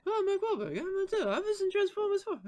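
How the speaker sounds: worked-up actor turned squeaky toy; squeaky Mark Wahlberg. Acted. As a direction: squeak like an angry actor in a scene. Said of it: "He just turns into a fucking squeaky toy".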